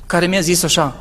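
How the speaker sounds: ordinary delivery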